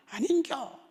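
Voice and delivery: put-on high, loud old Gyeongsang granny; Gyeongsang dialect. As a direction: mimic a Gyeongsang grandmother scolding. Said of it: "그걸 못 바꿔요".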